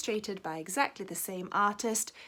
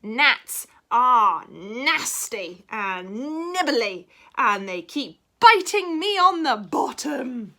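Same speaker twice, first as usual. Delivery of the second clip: complaining cat; 4 semitones higher, 10 dB louder, much slower than usual. Acted. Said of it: "said the cat".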